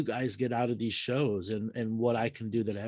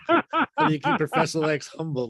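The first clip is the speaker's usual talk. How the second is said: laughing; 6 semitones higher, 8 dB louder than usual. Real, not acted. Repeating caller's joke, laughing.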